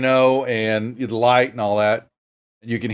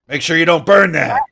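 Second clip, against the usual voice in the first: higher, punchy female orc bellow; gruff orc barking orders; 4 semitones higher, 5 dB louder than usual. Acted. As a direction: bark it at the kitchen crew like a rough orc boss. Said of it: "a female gruff voice, yelling, you know, barking some orders".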